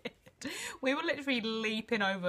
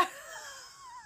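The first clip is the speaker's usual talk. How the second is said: squeaky, breathless crying laugh; high crying laugh; 14 dB quieter than usual. Real, not acted. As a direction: crack up till it squeaks.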